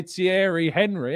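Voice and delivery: Thierry Henry said "HEN-ree", laughing; anglicised name. Shown as a demonstration. Joking to chat, says Henry the English way. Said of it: "I'm not gonna say".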